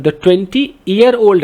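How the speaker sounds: "year" given weight, phrase read very slowly; slow read with "year" marked; stress on year. Shown as a demonstration. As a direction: lean on "year", not years. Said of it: "ईयर है".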